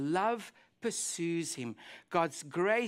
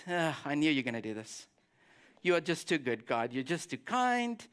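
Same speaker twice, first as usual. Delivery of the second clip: sulky mutter; 6 semitones lower than usual. Retold or imitated. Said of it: "he's sulking".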